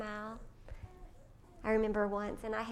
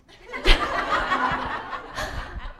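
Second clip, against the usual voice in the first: high burst of laughter at herself; loud laugh; 10 semitones higher, 14 dB louder than usual. Real, not acted. Mother laughs at her own Christmas impatience.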